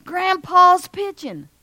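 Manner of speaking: high, drawn-out call across the field; loud yell. Acted. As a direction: shout it to Carl from the stands. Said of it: "somebody yells real loud".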